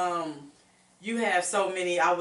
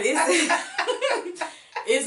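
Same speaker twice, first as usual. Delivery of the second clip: louder, laughing reassurance; 10 semitones higher, 6 dB louder than usual. Real, not acted. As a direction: laugh to lighten the tears. Laughing comfort after tears.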